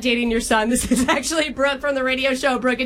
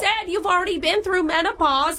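high, gossipy bad mom accent; high-pitched Long Island-Minnesota jumble; hammed-up jumbled accent; 6 semitones higher than usual. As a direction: share juicy gossip in a regional accent mash-up. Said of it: "doing a horrible accent".

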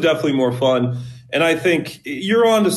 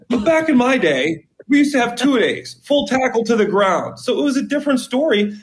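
emphatic storytelling; 6 semitones higher than usual. Real, not acted. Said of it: "I'd sound like a grandpa telling war stories".

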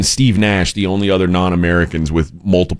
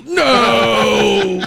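anguished shout; 13 semitones higher than usual. Retold or imitated. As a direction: scream it in horror. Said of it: "and he's".

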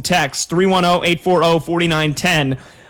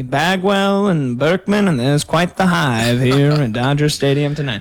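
slow baseball broadcaster impression, dropping lower; Vin Scully; 4 semitones lower than usual. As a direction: ease through it like a play-by-play caller. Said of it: "I was catching some Vin Scully".

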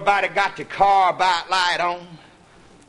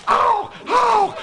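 alarmed cries. Retold or imitated. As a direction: cry out at the fight. Coon fighting the dogs.

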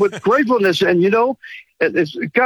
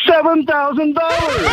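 high, drawn-out thrilled reveal; excited announcement; 8 semitones higher than usual. Real, not acted. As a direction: announce the big number with real excitement. Caller reveals his big silver bonus.

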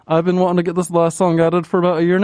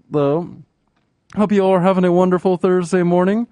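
ordinary talk, nothing marked

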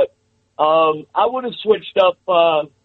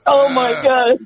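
laughing; 7 semitones higher than usual. Real, not acted. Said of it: "I can't stop laughing".

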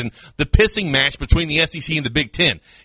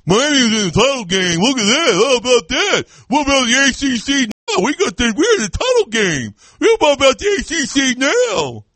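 mock gloating fan; 7 semitones higher than usual. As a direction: ham up a bragging fan. Mocking gloating Louisville fans.

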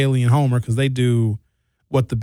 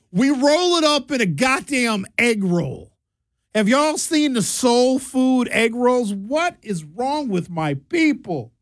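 slow, higher, disbelieving drag; mock-appalled slow whine; exasperated; 7 semitones higher, slower than usual. Real, not acted. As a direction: stretch it out in comic despair. Exasperated rant about soul food.

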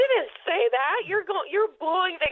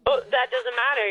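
usual speaking voice

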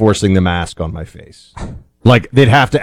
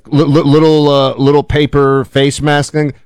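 a mild sneer, pitched up on the little mask; mocking; 5 semitones higher than usual. Co-host scoffing at paper masks, mildly mocking.

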